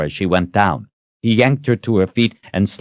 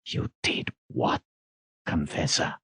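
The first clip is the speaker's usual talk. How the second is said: dangerous whisper; 3 semitones lower, 11 dB quieter than usual. A narrator's reading. Zed confronting Kalen over her confession, dangerously whispering. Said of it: "Zed's voice came in a dangerous whisper".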